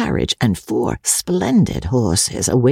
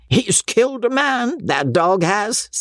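put-on gloating old baronet; 9 semitones higher than usual. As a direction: relish it like a crude old squire. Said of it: "said Sir Pitt".

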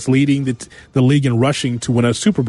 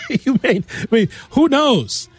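laughing; 9 semitones higher, slower than usual. Real, not acted. Laughs at own uncertain point.

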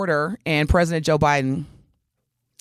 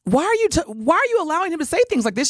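exasperated disbelief; 8 semitones higher, faster than usual. Real, not acted. Exasperated questioning Biden's staff.

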